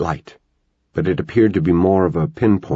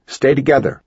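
yelled; 8 semitones higher than usual. A narrator's reading. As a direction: keep yelling the order. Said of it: "Connor yelled back".

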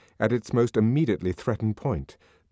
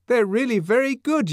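high, raised, coaxing voice; nervously placating; 5 dB louder than usual. A narrator's reading. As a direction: coax with a nervous smile. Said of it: "with a rather nervous smile of propitiation".

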